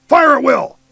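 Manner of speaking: high, loud barked order; shouting. A narrator's reading. Major ordering his squad to shoot, loud shout. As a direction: roar the command to open fire. Commander ordering fire in battle.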